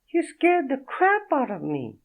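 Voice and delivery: a startled French woman, voiced high; French accent. Naked woman startled by Fredo, in a high French accent. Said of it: "Thick French accent".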